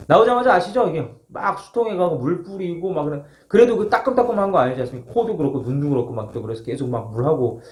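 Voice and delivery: normal talking voice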